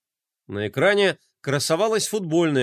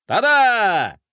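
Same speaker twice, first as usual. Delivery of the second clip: higher and louder, a showman's ta-da; triumphant exclamation; 6 semitones higher, 6 dB louder than usual. Acted. Bublik strikes his Picasso pose, triumphant. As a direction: present it with a flourish. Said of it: "победно воскликнул он".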